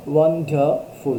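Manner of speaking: "Wonderful" in three parts, leaning on the middle "ডার"; teacher's syllable-by-syllable "wonderful", stress on "ডার"; stressed syllable demo. Shown as a demonstration. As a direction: break up "wonderful" and press on "ডার". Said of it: "ডার উপর একটা stress পড়ছে".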